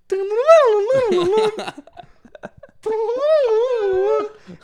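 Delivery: high trilled ringing noise, laughing; imitated phone noise. Showing what he heard waking up, high imitated ringing. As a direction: trill loudly like a phone going off.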